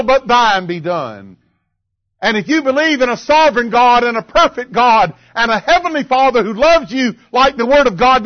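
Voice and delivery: high, emphatic pulpit delivery; impassioned preaching. Acted. Preacher urging congregation to pray, impassioned. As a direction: drive the phrases home, rising in force.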